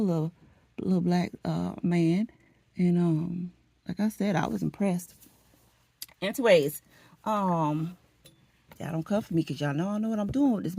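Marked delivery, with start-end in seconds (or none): none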